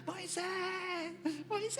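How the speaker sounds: high, thin faraway call; distant call from below. Acted. Disciple calling Moses from below, high distant-sounding call. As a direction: call up from a long way down. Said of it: "Y se escucha, ... desde abajo".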